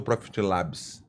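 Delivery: "Labs" said the Portuguese way, as "lábis"; Portuguese pronunciation. Shown as a demonstration. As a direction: read "Labs" with an open Brazilian a. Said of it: "Labs em, em português ou Labs em inglês". ("Labs" com pronúncia portuguesa, soando "lábis")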